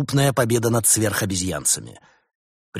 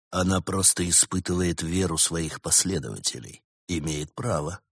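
low, quiet voice; 4 semitones lower, 5 dB quieter than usual. A narrator's reading. Bars answers rival on phone.

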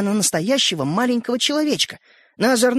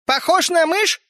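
higher, offended little man; a touch of toy-man huffiness; little man bristling at being called a mouse; angrily; 8 semitones higher, 3 dB louder, slower than usual. A narrator's reading. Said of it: "сердито поинтересовался человечек".

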